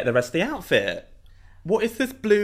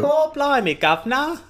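high, hammed-up Cockney accent; silly mock Cockney; 11 semitones higher, 3 dB louder than usual. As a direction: play a comic Cockney street urchin. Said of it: "doing British accents".

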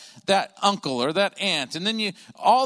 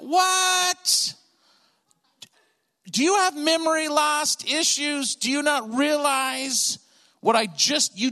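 high, incredulous yell of a fed-up dad; exasperated outburst; 8 semitones higher, slower than usual. Retold or imitated. Dad erupts at five-year-old asking for candy, exasperated. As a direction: act out an outraged, drawn-out "what?" and tirade. Father erupts at son's request.

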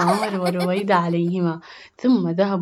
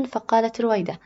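usual speaking voice

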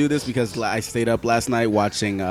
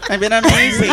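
mocking echo; 11 semitones higher, 6 dB louder than usual. Echoing Dom's answer.

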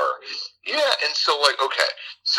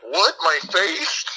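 mock alarmed student; 11 semitones higher, slower than usual. Voices student worried about his looks.

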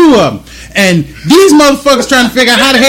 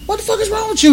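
higher, softer disbelieving outburst; incredulous; 13 semitones higher, 6 dB quieter than usual. Retold or imitated. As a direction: ask like he's lost his mind, pitched up. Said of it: "I'm like".

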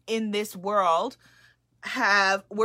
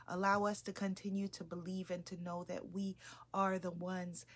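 soft, hushed, reverent prayer; 4 semitones lower, 12 dB quieter than usual. Acted. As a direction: pray quietly over the circle. Leads a prayer.

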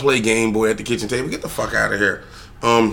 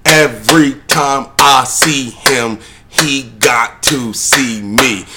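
daughter's proud boast; 4 dB louder, much slower than usual. Retold or imitated. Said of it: "She was like".

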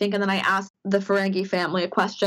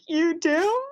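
amazed you do; 9 semitones higher than usual. Retold or imitated. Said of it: "And I was like".

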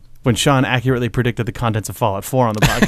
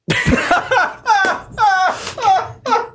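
long high wheezing laugh; high helpless laugh. Real, not acted. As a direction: lose it completely. Laughing at the joke.

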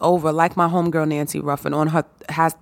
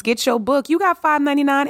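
excited pitch, high; 8 semitones higher than usual. Real, not acted. Pushing listeners to buy.

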